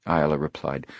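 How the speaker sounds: ordinary delivery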